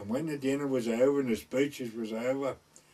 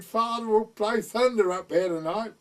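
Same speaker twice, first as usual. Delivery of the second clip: higher, laughing a little; lightly voiced teasing daughter; daughter's voice; 8 semitones higher, 6 dB louder than usual. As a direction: imitate the rector's daughter, amused and pitched up. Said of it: "She said".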